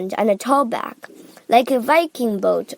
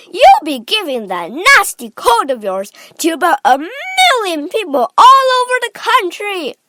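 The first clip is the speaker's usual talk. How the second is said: scolding shout climbing higher; Missus Gloop; 8 semitones higher, 7 dB louder than usual. A narrator's reading. Mother scolding her son about his cold, shouting. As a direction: scold loudly, building to a high peak. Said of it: "shouted Missus Gloop".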